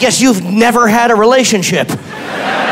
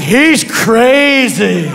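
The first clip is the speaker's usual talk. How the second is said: slow mock gossip; much slower than usual. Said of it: "people were like".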